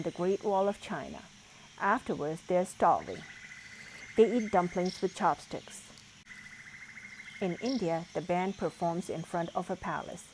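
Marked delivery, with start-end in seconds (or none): none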